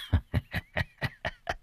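airy, breathy sly snicker; sly chuckle. Acted. Master narrowing his eyes at the plan, sly airy snicker. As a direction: snicker to yourself, pleased.

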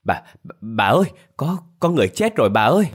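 frightened stammer rising high and fast; trembling panic. A narrator's reading. Maid reporting a death to her mistress, trembling panic. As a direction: stutter in fear, then cry out in a high, hurried voice. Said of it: "mà run rẩy nói".

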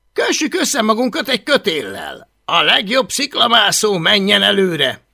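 put-on loud, high, swaggering boy leader; bossy bully leader. Boastful Viking boy bossing the others, loud and high. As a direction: bark orders like the self-made chief. Leader gives climbing orders.

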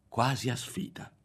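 usual speaking voice

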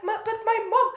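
high, loud stammered objection; panicky protest. Retold or imitated. Patient protesting to acupuncturist about mom, mock panic.

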